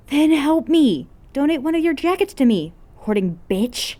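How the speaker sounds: bully him for a jacket; girl yelling at a friend for his jacket; demanding shout. Acted.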